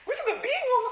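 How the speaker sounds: high giggly disbelief, laughing through the words; can't stop laughing at the memory. Real, not acted. Telling viewers the man cried, laughing high. Laughs recalling crying boyfriend.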